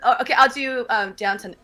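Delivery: normal talking voice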